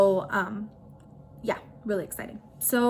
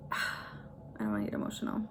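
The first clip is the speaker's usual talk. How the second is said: holding back tears; 9 dB quieter, much faster than usual. Real, not acted. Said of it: "Why am I crying?".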